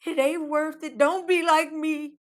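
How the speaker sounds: high, loud pleading warning from a stranger; voice from the back. Retold or imitated. Someone in the back warning her not to do it, pleading. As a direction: play a worried stranger begging her to stop. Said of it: "I almost heard somebody in the back".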